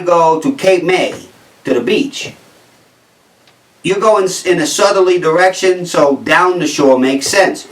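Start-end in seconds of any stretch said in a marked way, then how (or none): none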